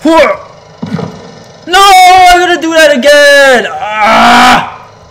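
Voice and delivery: very high, loud wail; screamed frustration. Real, not acted. Player messes up the jump, screamed frustration. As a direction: scream it out at the top of the voice. Screams after failing in the game.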